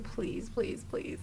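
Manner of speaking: hushed, pleading repetition; retold whispered-style prayer; praying. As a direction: softly beg under your breath. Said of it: "I was, like, praying".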